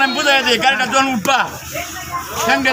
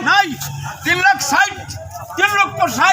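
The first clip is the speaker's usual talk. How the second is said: auctioneer; 4 semitones higher than usual. Acted. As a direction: call out the bids like an auctioneer. Auctioneer repeats bid.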